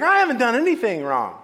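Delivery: high, loud indignant outburst; acted self-righteous anger; sudden anger. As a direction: protest your innocence hotly. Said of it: "All of a sudden he was angry".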